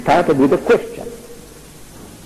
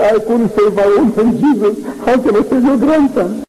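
helpless excuse; 6 semitones higher than usual. Retold or imitated. Allah's tearful excuse, quoted.

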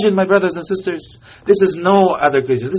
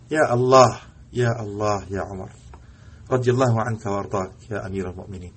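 hushed invocation; 9 semitones lower, 8 dB quieter than usual. Real, not acted. Emotional invocation after Umar story.

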